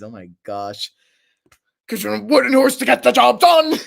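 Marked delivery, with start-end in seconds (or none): 1.9-3.7 high, loud, forceful shout; Ares impression. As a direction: bellow it as a war god. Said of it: "Knife as Ares".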